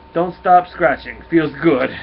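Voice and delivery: put-on loud, slow Russian accent; contented Russian dog, drawn out and emphatic; Cosmo. Cosmo enjoys being scratched, slow loud Russian accent. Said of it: "always wanna do a Russian accent".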